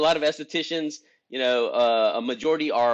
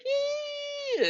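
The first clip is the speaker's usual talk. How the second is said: sudden squeaky jump way up high; voice crack squeak; 5 dB quieter than usual. Shown as a demonstration. Showing his group how his voice cracks, a high squeak. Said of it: "my voice, like".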